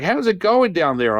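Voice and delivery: high singsong inquiry; imitated mother calling. Mother asking the man at her foot, high-voiced inquiry. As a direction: lift it high, checking in.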